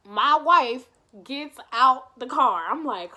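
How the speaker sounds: ordinary talk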